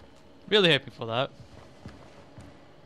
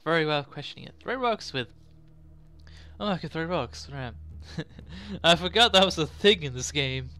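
ordinary talk

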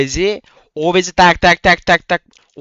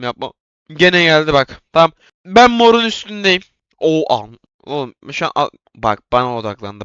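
ordinary delivery

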